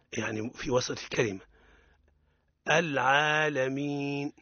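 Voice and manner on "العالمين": العالمين slow, ع firm and not bent toward i; model recitation. Shown as a demonstration. Teacher modeling العالمين for students, slow and clear. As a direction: draw out العالمين slowly, ع held clear. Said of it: "ننطق به مركبا".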